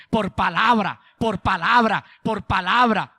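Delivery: loud, insistent repetition; emphatic refrain. Real, not acted. Preacher leading the congregation to repeat, loudly emphatic. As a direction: pound out the phrase for the crowd.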